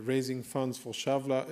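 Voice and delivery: usual speaking voice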